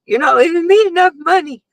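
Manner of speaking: high, pouty mock whine to her husband; whiny complaint. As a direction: play up the complaint about the money. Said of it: "So I was like".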